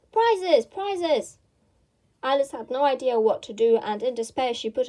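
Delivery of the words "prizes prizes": loud jumbled calls; calling out. A narrator's reading. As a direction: shout it like an excited crowd. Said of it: "calling out in a confused way".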